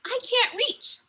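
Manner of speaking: put-on a kid who can't reach the hold; high young girl voice. Sophia at the wall, complaining she can't reach, high girl voice.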